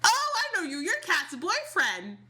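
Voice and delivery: a hint of a beaming grandfather; grandpa voice. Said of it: "Grandpa Dupree is like".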